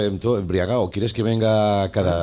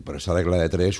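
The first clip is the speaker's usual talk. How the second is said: ordinary delivery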